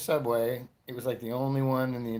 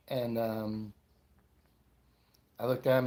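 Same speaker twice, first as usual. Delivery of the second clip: normal talking voice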